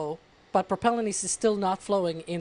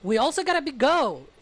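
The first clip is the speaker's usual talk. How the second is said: excited; 7 semitones higher, 4 dB louder than usual. Pretend. Hyped mock go poll.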